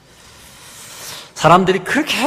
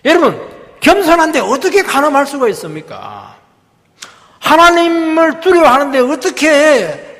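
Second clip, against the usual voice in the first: impassioned preaching; 8 semitones higher, 5 dB louder than usual. Real, not acted. Preacher challenging the congregation on humility and sin, impassioned. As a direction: press the rhetorical questions on the congregation.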